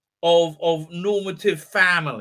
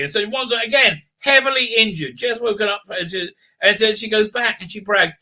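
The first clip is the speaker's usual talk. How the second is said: ordinary delivery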